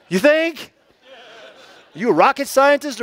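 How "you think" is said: sarcastic laugh. Real, not acted. Pastor laughing off his friend's obvious remark, sarcastic. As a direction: laugh it out, high and mocking.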